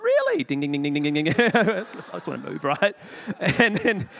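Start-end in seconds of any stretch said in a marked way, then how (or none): 0.0-0.4 very high and louder, alarmed; panicked really to the ex-prisoner. Retold or imitated. Alarmed, reaching for call bell. 0.4-1.3 imitated call bell.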